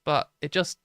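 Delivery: ordinary talk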